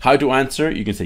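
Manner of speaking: ordinary talk, nothing marked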